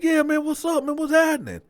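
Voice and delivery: very high, eager reply; excited greeting. Retold or imitated. Teller answering Bo's greeting, excited. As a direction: greet him back with the pitch way up.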